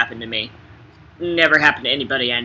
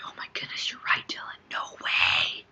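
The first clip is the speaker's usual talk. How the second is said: hushed whisper; 7 semitones lower, 9 dB quieter than usual. Retold or imitated. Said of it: "Everybody was like".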